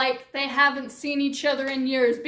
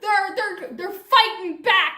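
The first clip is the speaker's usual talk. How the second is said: higher, louder, rushing alarm; mock panic; 4 semitones higher, 3 dB louder than usual. Narrator warns the world, hammed-up panic shout. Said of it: "own building panic, shouted".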